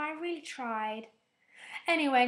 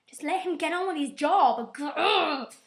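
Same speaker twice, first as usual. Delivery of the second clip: louder, higher exasperated groan; fed up, tell her to stop it; 5 semitones higher, 8 dB louder than usual. Retold or imitated. Viewer fed up with Uhura, exasperated outburst. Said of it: "I'm just like".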